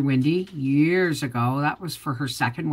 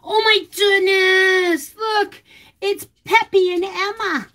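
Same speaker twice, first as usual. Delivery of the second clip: high, loud, slow exclamation; overjoyed; 4 dB louder, slower than usual. Real, not acted. Finding a photo of her dogs, overjoyed high squeal.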